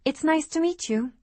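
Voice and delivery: high, cheerful waitress greeting; falsely cheerful customer-service lilt. Acted. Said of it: "in my best waitress voice".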